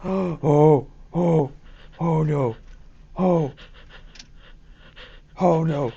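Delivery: startled gasping oh no; panicked loud gasps; jump scare gasp. Real, not acted. Jump scare hits mid video.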